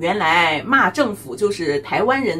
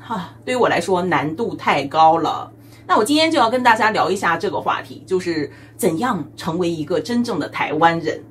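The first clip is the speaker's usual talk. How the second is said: ordinary talk, nothing marked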